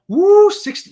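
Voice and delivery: loud high woo over the warm temperature; excited cheer. Real, not acted. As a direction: cheer it out, pitch up.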